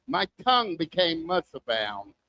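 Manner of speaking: ordinary delivery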